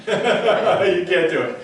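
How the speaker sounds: slow, laughing through the words. Real, not acted. Teasing Joanne after the trick fails, laughing. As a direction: chuckle it out, slowly.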